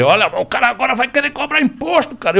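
high mocking complaining voice; indignant rancher. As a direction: whine like an outraged rich farmer. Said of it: "esses caras não vão dizer".

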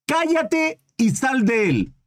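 slow, a hint of a commanding Jesus; lightly voiced stern command; stern rebuke. A narrator's reading. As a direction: rebuke the spirit firmly and slowly. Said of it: "le reprendió diciendo".

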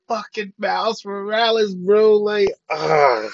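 high, loud fan yell breaking into a cry; excited outburst. Retold or imitated. Reliving everybody's reaction to the Miles hint, excited yelling. Crowd's ecstatic reaction, acted out.

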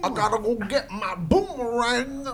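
high, put-on Australian accent; hammed-up Australian twang in a high voice; Australian Cosby. Shown as a demonstration. Host jumping in on the impression challenge, high Australian accent. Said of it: "do him in an Australian accent".

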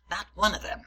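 normal talking voice

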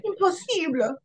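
mock French accent. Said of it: "My horrible French accent".

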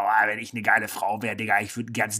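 mock boastful man's voice, lightly put on; imitated guy talk. Host quoting what men always say, mock laddish. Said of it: "die Männer sagen immer".

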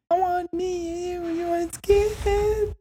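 squeaky voice breaking way up high; sing-song jingle voice cracking into falsetto; voice cracking up an octave. Acted. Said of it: "voice cracked".

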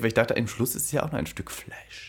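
whispered cheeky aside. Real, not acted. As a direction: drop to a whisper for the naughty thought. Confides a cheeky thought.

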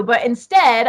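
normal talking voice

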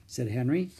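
ordinary delivery, nothing marked

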